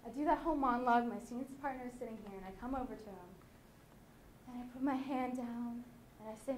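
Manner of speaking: ordinary talk, nothing marked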